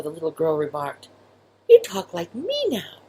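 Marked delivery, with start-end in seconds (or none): 1.7-3.0 high mouse voice. Acted.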